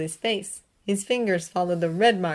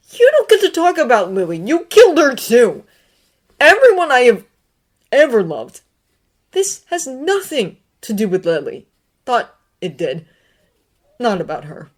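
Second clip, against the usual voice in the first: much higher and louder, raging; furious outburst; 6 semitones higher, 11 dB louder than usual. A narrator's reading. Severus raging at Dumbledore over Lily. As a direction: explode at him.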